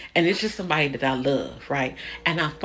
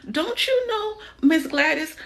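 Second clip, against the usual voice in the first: mock scolding; 9 semitones higher than usual. Repeat of scolding plea.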